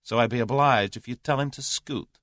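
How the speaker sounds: dryly forthright American. A narrator's reading. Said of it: "said Julius dryly".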